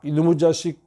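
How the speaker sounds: ordinary talk, nothing marked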